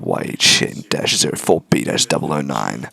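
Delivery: slow low code number; low villain voice; villain reads code. Acted. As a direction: read it out coldly. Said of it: "Doing that code number".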